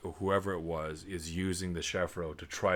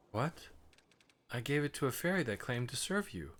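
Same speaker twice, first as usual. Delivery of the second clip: voices a game character higher than own voice; 8 semitones higher than usual. Acted. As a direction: pitch it up for the fairy-tale character. Voices game dialogue, high.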